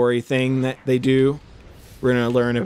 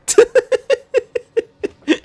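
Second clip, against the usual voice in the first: high, loud cackling laugh; real laugh; 6 dB louder than usual. Real, not acted. Gamer after plugging own episodes, laughing hard. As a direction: burst out in a long staccato laugh.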